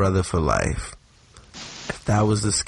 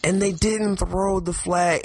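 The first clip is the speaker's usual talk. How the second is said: exasperated; 8 semitones higher than usual. Retold or imitated. Recounting outrage at missed call.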